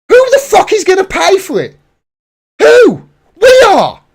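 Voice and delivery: high, loud furious cry; a burst of raw outrage; angry outburst. Real, not acted. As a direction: explode with real anger. Angry rant peaks.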